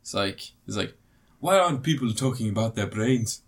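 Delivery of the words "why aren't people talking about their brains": imitating Will Smith's put-on African accent; mock earnest Will Smith in Concussion accent. Mocking Will Smith's Concussion role, put-on African accent. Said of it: "a Will Smith impression of doing someone African".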